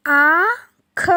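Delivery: आँख stretched very long with nasal आँ; drawn-out nasal word. Shown as a demonstration. Teacher answering her own question, nasal demo. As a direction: hold आँख through the nose. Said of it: "तो इसको कैसे बोलेंगे?".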